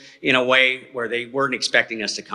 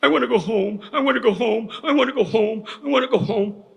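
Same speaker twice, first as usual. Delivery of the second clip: a higher, childlike plea, retold, repeated like a scared kid; panicky meltdown; 8 semitones higher than usual. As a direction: mimic a little boy begging to leave the berry patch. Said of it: "he goes into a meltdown".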